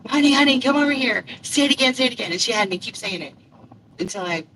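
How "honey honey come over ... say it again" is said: louder, higher imitation of an excited lady; eager summons. Airport lady begging her to repeat it, eager. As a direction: call someone over, insistent and delighted. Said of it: "She was like".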